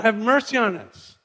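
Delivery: high, drawn-out plea from afar; calling out. Acted. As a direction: call out for mercy across a distance. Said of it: "they're calling out".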